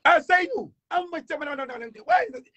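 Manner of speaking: high, fast chatter on the phone; phone chatter impression. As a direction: babble into the phone, animated. Imitating a Malian on the phone. (imitation d'un homme au téléphone, aigu et animé)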